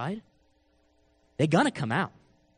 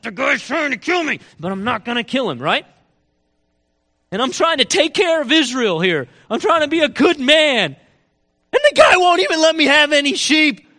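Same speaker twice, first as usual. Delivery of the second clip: building from firm to a furious higher shout; explodes in rage; 7 semitones higher, 7 dB louder than usual. Retold or imitated. Preacher acting David furious at Nabal, rising to a shout. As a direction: let the rage climb until it bursts on kill him. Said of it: "he just explodes in a rage".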